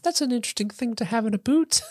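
high airy mock Luna voice; dreamy Luna. Imitating Luna puzzling over a boot, high and airy. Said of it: "Luna's like".

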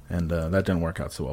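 ordinary talk

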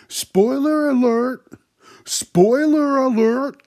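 very high, loud, drawn-out holler; hammed-up shout imitating a loudmouth; mock yelling. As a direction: holler it slowly across the room. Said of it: "he just starts yelling shit".

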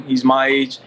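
ordinary talk

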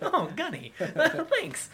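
high, laughing thanks. Retold or imitated. Wounded marine laughing his thanks to the gunny, high. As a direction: laugh through the thank-you.

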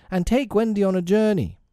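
put-on high-pitched boy's call. A narrator's reading. Nibs sending Tink on a journey, calling high. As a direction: pass on the order, calling high. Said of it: "Nibs called".

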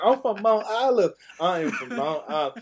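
put-on a starstruck kid pleading his case; excited. Teen telling Steve McNair his hometown, high and loud. Kid self insisting to cousin.

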